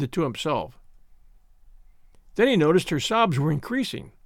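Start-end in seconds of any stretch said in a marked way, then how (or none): none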